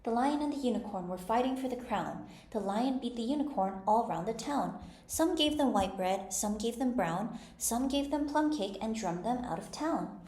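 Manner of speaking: measured, lilting nursery-rhyme recitation; recited old rhyme. A narrator's reading. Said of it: "repeating to herself as she ran".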